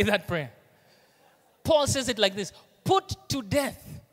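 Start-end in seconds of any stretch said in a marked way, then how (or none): 2.8-4.0 slow solemn reading. Acted. Slow quoted scripture.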